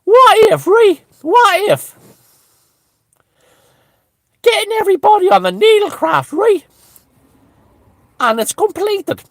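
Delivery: high squeaky falsetto musing; mock Deco spinning his theory; Deco impression. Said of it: "I'll let Deco explain the next part".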